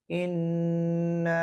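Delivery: इन्ना with the nun held long through the nose; very slow इन्ना, nasal nun held; nasal ghunna. Shown as a demonstration. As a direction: hum the nun of इन्ना briefly. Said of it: "नाक के अंदर आवाज़ को रोकना थोड़ी देर के लिए".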